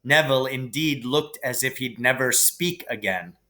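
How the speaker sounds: ordinary delivery, nothing marked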